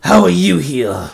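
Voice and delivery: slow, hostile demand; gruff, suspicious bark. A narrator's reading. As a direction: bark the question at an old enemy. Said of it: "Zanaki barked".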